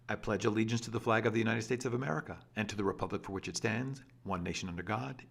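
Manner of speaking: fast, rushed reading as one long sentence; run-on reading. Shown as a demonstration. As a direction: rattle it off in one breath. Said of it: "Take a look".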